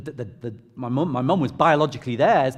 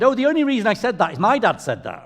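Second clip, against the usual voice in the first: imitated mum, higher and louder; protesting mum; 8 semitones higher, 6 dB louder than usual. Mum explaining to her son where it came from, high protesting voice. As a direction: mum passing the blame, pitched up. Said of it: "She said".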